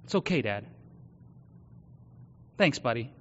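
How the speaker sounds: softly voiced young son, gentle; quiet son's reply. Son softly reassuring his father, quiet and gentle. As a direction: lightly voice a kid reassuring his dad, soft. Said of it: "because he's got the same light".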